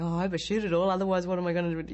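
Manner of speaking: loud, fast, worried rush; anxious fretting. Retold or imitated. Actress on a packed last day, fretting loudly and fast. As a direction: panic about not finishing in time. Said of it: "And I'm going".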